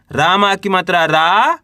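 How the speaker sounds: slow, रा held with a rising tune; spelling aloud. Shown as a demonstration. Teacher spelling a word for learners, slow with stretched रा. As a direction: spell it out and lift the final रा. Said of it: "तो कैसे पढ़ेंगे?".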